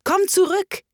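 high-pitched call; calling after someone. A narrator's reading. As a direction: call out to someone walking away. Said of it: "rief ihr die Raupe nach".

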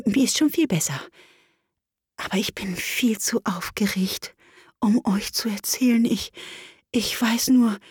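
faint whispered little voice; barely whisper, weak and shaken; weak squeaky voice. Acted. Wabbel tells the others weakly, in a whisper. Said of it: "kleine, schwache, quiekende Stimme".

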